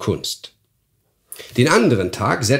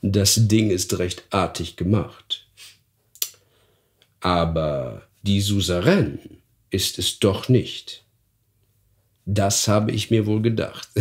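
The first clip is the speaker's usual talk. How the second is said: sly guest. Acted. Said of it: "lächelte dabei höhnisch und geheimnisvoll".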